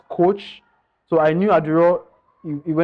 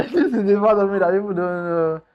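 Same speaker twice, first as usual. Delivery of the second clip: laughing through real emotion; a shaky laugh to cover near-tears; teary laugh; 6 semitones higher than usual. Real, not acted. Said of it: "I'm getting emotional".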